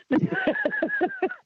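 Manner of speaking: squealing laugh burst; high-pitched amused laugh. Real, not acted. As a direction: break into a high giggle. Laughing at cop story.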